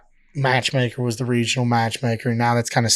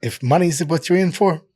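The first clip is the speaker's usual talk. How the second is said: Vince lisp voice. Retold or imitated. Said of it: "he's got a lisp, and he, you know, kinda got a higher voice".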